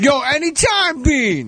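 high, whooping comedian impersonation; exaggerated high comic character voice; Tracy Morgan. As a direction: pitch it way up, stretched and sing-song. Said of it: "thank you, Tracy".

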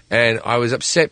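usual speaking voice

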